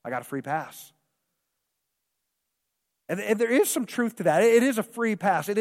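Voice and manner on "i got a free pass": soft, careless brush-off with a scoff; dismissive shrug. Retold or imitated. Pastor mocking his old careless self, dismissive and soft. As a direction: wave it off like it doesn't matter. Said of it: "I was just like".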